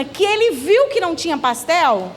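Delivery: ordinary talk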